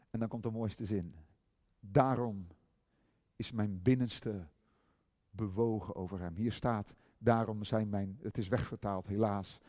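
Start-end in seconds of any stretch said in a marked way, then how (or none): none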